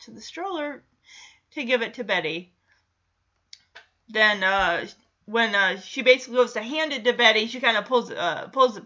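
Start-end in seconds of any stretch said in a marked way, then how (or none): none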